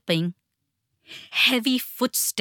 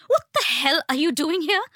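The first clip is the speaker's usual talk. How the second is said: high, alarmed, indignant challenge; angry mother, high and rattled; shaken and angry; 8 semitones higher, 3 dB louder than usual. A narrator's reading. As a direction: challenge an intruder, pitch raised. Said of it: "Her mother's voice seemed shaken and angry".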